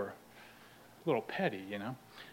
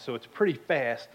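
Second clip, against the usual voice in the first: normal talking voice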